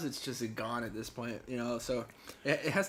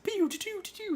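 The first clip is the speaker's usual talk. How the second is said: pinball sound effects; 5 dB louder than usual. Retold or imitated. Imitating pinball game sounds.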